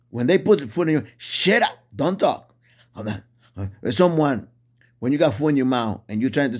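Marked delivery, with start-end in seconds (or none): 1.2-2.5 mocking shut up. Imitating people who silence Christians, high mock scolding. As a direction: sneer out a rude silencing.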